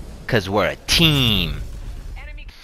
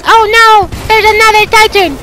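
mock panic; 7 dB louder than usual. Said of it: "They're just like".

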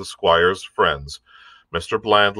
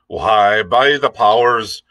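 louder, hearty pirate exclamation; cried out; 5 dB louder, slower than usual. A narrator's reading. Long John cries out to the crew, loud and hearty. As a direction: cry it out like an old salt. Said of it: "cried Long John".

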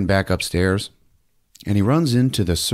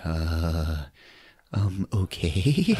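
hesitant, shaken; 3 semitones lower, 5 dB quieter, much slower than usual. Retold or imitated. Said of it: "she's like".

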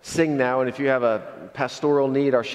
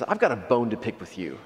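ordinary talk, nothing marked

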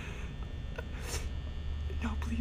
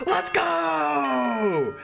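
high excited shout; hype shout; 10 semitones higher than usual. Real, not acted. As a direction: yell it out, pumped. Hyped shout to chat.

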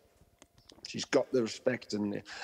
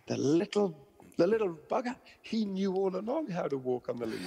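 owner's disbelief; 7 semitones higher than usual. Retold or imitated. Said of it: "They're like".

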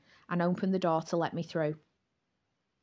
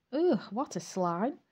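disgusted; 2 semitones higher, slower than usual. A narrator's reading. Disgust at a creep.

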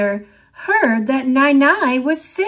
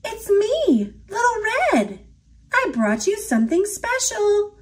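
put-on much higher sweet girl voice; little girl; 10 semitones higher than usual. A narrator's reading. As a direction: chirp it like a small girl. Little girl at grandma's bed.

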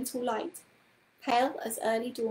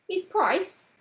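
eager interruption; 8 semitones higher, 4 dB louder than usual. A narrator's reading. Paul interrupting Miss Price eagerly.

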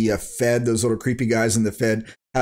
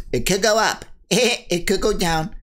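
high, whiny mock hedging, much higher than his own voice; hammed-up mockery of a cautious YouTuber, last line rushed; mocking wimpy YouTuber; 10 semitones higher than usual. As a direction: sneer at a timid pundit by hedging in a high voice. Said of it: "wimpy cuck YouTubers going".

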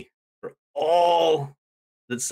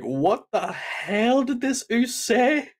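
high, drawn-out disbelieving question; mock incredulous; 11 semitones higher, slower than usual. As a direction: stretch it out in pitched-up bafflement. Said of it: "visibly like".

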